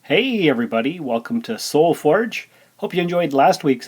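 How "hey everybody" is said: normal talking voice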